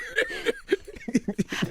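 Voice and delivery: high burst of laughter; high cackling laugh. Real, not acted. Laughing at herself.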